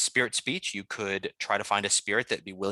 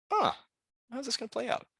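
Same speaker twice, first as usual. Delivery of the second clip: amused musing; 13 semitones higher than usual. Acted. Player's character watches John and the spirit, amused. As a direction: muse to yourself as if privately tickled. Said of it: "you're kinda chuckling like".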